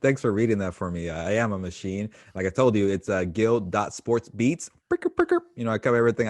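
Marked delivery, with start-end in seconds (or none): 4.9-5.4 high trilled mock horn noise; playful vocal sound effect. Guest plugging his sports beat, playful vocal sound effect. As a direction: roll out a high, silly "brrka brrka". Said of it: "I should stop doing that".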